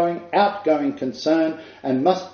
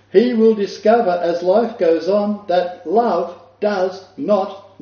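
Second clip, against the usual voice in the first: slow, higher and pressing, stress on will; slower emphatic repeat; 5 semitones higher, slower than usual. Shown as a demonstration. Preacher repeating the quote so it sinks in, slow and pressing. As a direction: lean on every word so it sinks in. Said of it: "Let me repeat that because it needs to sink in".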